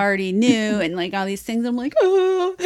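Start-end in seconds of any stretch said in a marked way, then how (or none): 2.0-2.6 high-pitched little wail; mock crying. Reader recalling a touching story, mock crying. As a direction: imitate being moved to tears. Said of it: "also crying".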